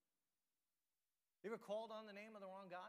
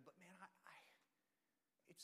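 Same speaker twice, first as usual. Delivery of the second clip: whisper; 15 dB quieter than usual. Real, not acted. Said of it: "confessing struggle".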